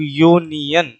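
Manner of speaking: यूनियन said slowly and softly, starting with a clear y sound; slow pronunciation demo. Shown as a demonstration. Teacher shows students the y sound in यूनियन, slow and soft. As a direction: draw out यूनियन gently so the y sound at the start is heard. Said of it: "जब मैं यूनियन का उच्चारण करता हूं".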